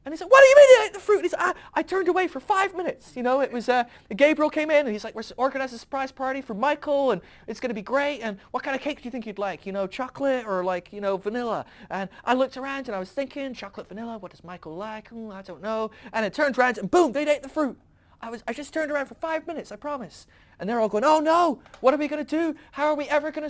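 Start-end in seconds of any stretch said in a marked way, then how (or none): 0.3-1.1 very high, shouted disbelief; hammed-up incredulous shriek; shocked outburst. God acted out hearing about the fruit, mock shock. 1.5-11.6 high, loud, panicky excuse; freaking out. Acted. Jesus acted out explaining his lapse, comic panic. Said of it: "I'm freaking out". 11.9-20.3 high-pitched pleading excuse, bursts of volume; freaking out. Acted. Jesus acted out promising it was five minutes, comic panic. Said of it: "I'm freaking out".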